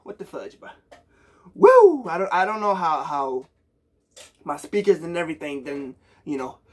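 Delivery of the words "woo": high loud woo of delight; excited woo. Real, not acted. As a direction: shout it out in joy. Hyped reaction to song.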